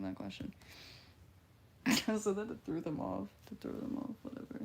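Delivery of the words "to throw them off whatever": ordinary delivery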